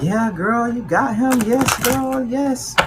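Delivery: put-on high, excited hype-girl voice; cheering girlfriend. Girlfriends celebrating that she got him, high and excited. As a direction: cheer her on like her bestie.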